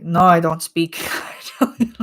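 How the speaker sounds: put-on Italian said with a light Japanese accent, a laugh inside; mock Japanese accent on "No, I don't speak Italian". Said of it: "Italian with a Japanese accent".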